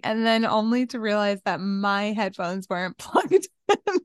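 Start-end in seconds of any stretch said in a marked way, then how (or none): none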